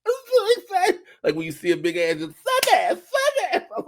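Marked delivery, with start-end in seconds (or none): 0.1-1.0 very high, squeaky imitation of excitement; mock overexcited squeal. Comedian showing how he felt, mock excited squeal. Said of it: "That's how I felt". 2.4-3.7 slap ass sketch impression. Comedian quoting a sketch to co-hosts, high excited squeal. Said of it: "Key and Peele sketch, the slap ass".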